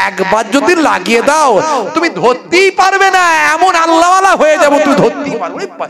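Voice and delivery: mock gleeful vow; excited high bargaining. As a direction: promise God the world for a win. Youth promising piety, excited.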